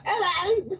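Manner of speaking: very high, loud falsetto hello; female impression. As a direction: squeal it like a bad lady voice. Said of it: "what I call my wife's impression".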